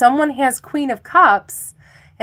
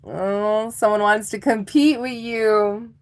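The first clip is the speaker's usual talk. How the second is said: ordinary talk, nothing marked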